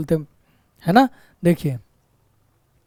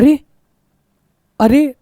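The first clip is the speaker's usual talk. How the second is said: sudden startled "arey", rising higher; surprised; 5 semitones higher, 3 dB louder than usual. Shown as a demonstration. Teacher shows students a surprised "arey". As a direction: gasp it out as if something just jumped out. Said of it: "अचानक कहते हैं".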